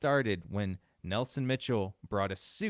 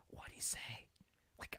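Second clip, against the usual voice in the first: mock baffled whisper; 18 dB quieter than usual. Mimics baffled listeners.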